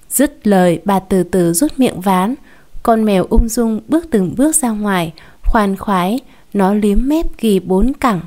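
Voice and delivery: usual speaking voice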